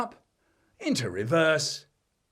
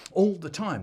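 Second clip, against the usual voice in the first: usual speaking voice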